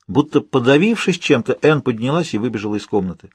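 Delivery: plain reading voice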